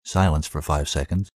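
plain reading voice

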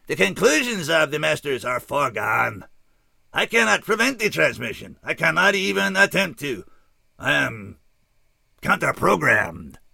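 a remorseful alien announcing doom; alien scout. A narrator's reading.

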